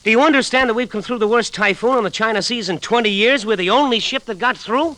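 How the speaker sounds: mate confronting the stubborn captain; indignant outburst. Acted. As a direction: let the frustration burst out.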